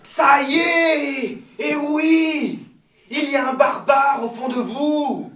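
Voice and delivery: loud, slow and high; triumphant shout. Real, not acted. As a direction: cheer in triumph. Teacher triumphant after the yawp.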